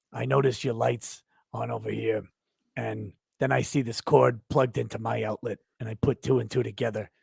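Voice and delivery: low, deadpan neighbour impression; Carl, lightly done. Fan retelling the neighbour's line about the lights, low deadpan impression. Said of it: "is when Carl comes over".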